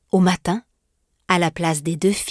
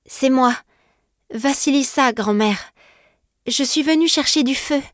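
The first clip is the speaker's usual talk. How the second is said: put-on higher, small girl's voice; lightly voiced young girl, higher; timid girl; 6 semitones higher than usual. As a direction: answer the witch meekly, higher. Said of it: "fit la Baba Yaga".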